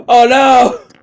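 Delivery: sudden loud high "oh no"; startled cry. Real, not acted. Gamer yelping as the challenge fails, loud and high. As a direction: yelp it out at the failure.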